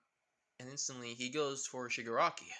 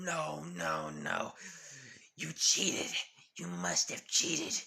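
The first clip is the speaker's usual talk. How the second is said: tantrum; 4 dB louder, slower than usual. Acted. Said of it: "He kind of goes on a tantrum".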